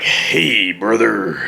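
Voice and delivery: a hint of a low Macho Man; mild low Macho Man greeting; lightly voiced Macho Man. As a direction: drop the voice a little for brother. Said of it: "he's like".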